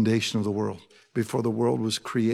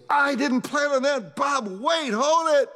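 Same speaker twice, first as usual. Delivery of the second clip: much higher, flustered mock panic; a hammed-up startled stop; mock surprised God; slower than usual. God caught off guard by Bob, hammed-up alarm. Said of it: "where he goes".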